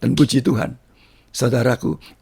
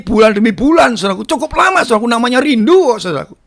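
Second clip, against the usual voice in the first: raised, impassioned testimony voice; fervent preaching; 9 semitones higher, 4 dB louder than usual. Acted. Preacher tells congregation of longing for God, fervent preaching. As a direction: preach it with rising fervour.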